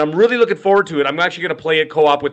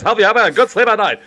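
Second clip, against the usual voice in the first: put-on bright old-time commercial pitch, higher; chipper 1950s ad; 7 semitones higher than usual. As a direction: sell it like a fifties cigarette ad. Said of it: "the guys who used to have the cigarette commercials".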